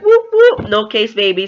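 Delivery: high, loud whooping cheer; celebratory cheer. Retold or imitated. Reader rooting for her favorite couple, cheering. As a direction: whoop it up for the couple you root for. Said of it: "so sh-, rooting for them".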